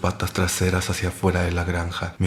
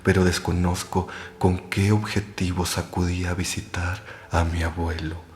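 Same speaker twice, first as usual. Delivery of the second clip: plain reading voice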